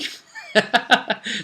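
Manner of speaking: high gleeful cackle; gleeful laugh. Acted. Game master relishes the players' danger, gleeful laughing. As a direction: laugh high at their doom.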